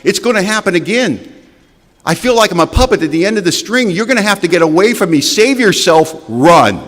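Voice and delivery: high, loud, pleading and frantic; desperate plea. Pretend. Alcoholic begging his family to run, high desperate plea. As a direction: beg them to flee from you. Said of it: "I can't say".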